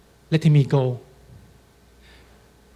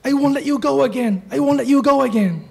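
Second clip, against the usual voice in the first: high-pitched, urgent mock yell; retold yelling; 10 semitones higher than usual. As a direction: cry it out high, acting out a grandma's yell. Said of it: "and yelling".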